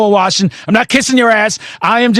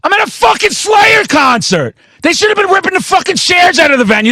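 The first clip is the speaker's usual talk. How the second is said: outraged shout; 11 semitones higher than usual. Real, not acted. Ranting to the podcast host about a security guard, outraged shout.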